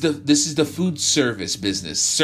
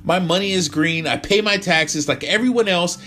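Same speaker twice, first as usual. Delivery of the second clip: angry rant; 13 semitones higher, 7 dB louder than usual. Acted. Tyler rants at the restaurant.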